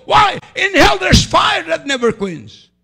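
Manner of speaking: preacher warning the congregation, loud and slow; fiery warning. Real, not acted. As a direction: thunder the warning about hell.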